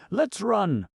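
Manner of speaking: very high, loud urgent plea; breathless begging. Acted. Betsy pulling Carter away, breathless frightened begging. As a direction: beg him to hurry, out of breath. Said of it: "she begged breathlessly".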